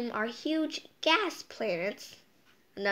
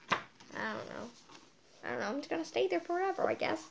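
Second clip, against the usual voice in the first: storm's voice; 5 semitones higher, 6 dB quieter, faster than usual. Pretend. Answering as the storm itself.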